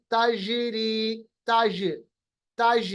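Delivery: ताज with a bouncing ज, slow ताजीरी; qalqalah on jeem. Shown as a demonstration. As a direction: echo the ज in ताज. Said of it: "देखिए कैसे मैं अदा कर रहा हूँ". (ताज में जीम का क़लक़ला)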